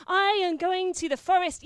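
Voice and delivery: loud, puffed-up boast; proud knight proclaiming his quest; pompous declaration. Acted. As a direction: announce it grandly, chest out.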